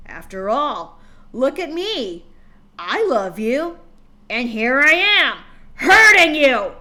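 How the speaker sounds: from loud to shouting, a cruel taunt; father taunting his daughter, building from loud to very loud. Acted. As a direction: rise to a cruel shout on hurting you.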